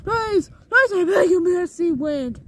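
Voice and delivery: high, loud desperate begging; pleading cry. Acted. Blueberry begs the wind for mercy, high and loud. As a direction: plead with the wind, rising in panic.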